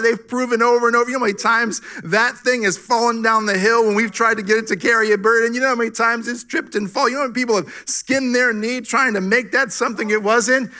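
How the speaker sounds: loud, high fervent preaching; full-force preaching. Acted. Pastor preaching loud to the congregation.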